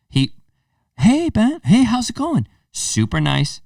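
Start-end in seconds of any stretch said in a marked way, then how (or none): 1.0-2.5 high, eager sing-song hello; pitched-up sweet polite teen voice; mock polite greeting. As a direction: imitate a kid being overly nice. Imitating the polite stepson.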